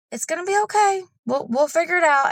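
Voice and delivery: a hint of the laid-back husband; calm husband. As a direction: imitate the husband's easy reassurance. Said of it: "he's more calm and he's more laid back".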